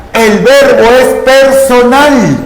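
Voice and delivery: usual speaking voice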